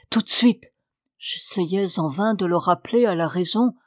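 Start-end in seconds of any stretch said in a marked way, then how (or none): 0.1-0.7 repeated plea. A narrator's reading. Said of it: "répétait".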